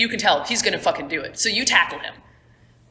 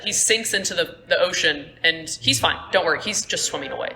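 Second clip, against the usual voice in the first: ordinary delivery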